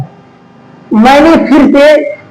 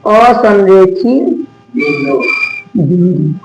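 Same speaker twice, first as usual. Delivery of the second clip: slow drill; 4 semitones lower, 3 dB quieter, much slower than usual. Shown as a demonstration. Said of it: "किसे जानेंगे?".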